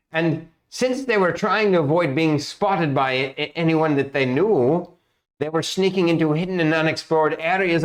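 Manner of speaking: guild master NPC. Acted. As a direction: GM playing a fretful guild master breaking bad news. GM voices Mister Zolk.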